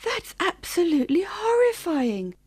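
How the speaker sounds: aghast, stressed disbelief; horrified. A narrator's reading. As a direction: react in shock and horror. Listener appalled by bear's account.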